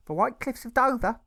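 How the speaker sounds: high, loud, gushing mother's voice; doting mother voice. A narrator's reading. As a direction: coo like a proud, fussing mother. Mother voiced praising son.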